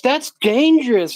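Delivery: mock scared guy. Mocking the guy at the gas pump, slow and high-pitched. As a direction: drag it out like a prissy worrier.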